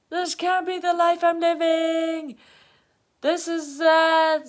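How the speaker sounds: high, loud melodramatic lament; disaster-mode wail. Acted. Her thoughts during a big emotion, high melodramatic wail. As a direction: wail it like everything is ruined. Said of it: "my thoughts go disaster mode".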